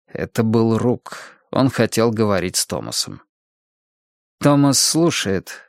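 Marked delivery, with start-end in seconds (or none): none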